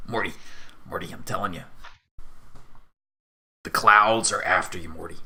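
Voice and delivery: insistent paranoid warning in a lightly put-on voice; Whelm. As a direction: warn your sidekick like a low-voiced crank. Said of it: "that was the wrong voice".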